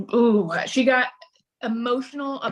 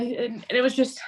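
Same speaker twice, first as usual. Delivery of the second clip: ordinary delivery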